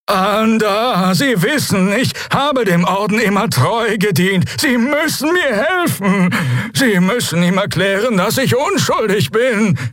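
very high-pitched alien begging in panic; panicked Draxyl. Acted. Prisoner begging the admiral to believe he is innocent, panicked. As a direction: plead desperately, high and drawn-out. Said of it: "bebte vor Panik".

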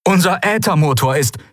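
high, urgent damage report; high-pitched radio caller in trouble; distress caller. A narrator's reading. Stricken captain reports by radio.

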